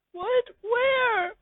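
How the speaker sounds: very high, wailing whine of the listener; high crying voice. Acted. The listener confessing to Pimpich in a high crying voice. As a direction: squeak it out like a crybaby.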